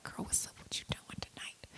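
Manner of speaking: breathy near-whisper, friendly and playful; hushed casual greeting. Pretend. Imagining hanging out with the actress, hushed playful greeting. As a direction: whisper it like greeting your new best friend.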